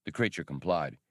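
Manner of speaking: plain reading voice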